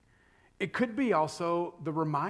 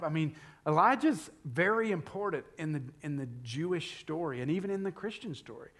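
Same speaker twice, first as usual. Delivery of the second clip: usual speaking voice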